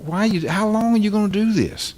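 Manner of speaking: Jesus pleading. Retold or imitated. Jesus looking at churchgoers, asking why, hurt. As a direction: cry out why like a betrayed giver. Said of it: "can't you just hear him say".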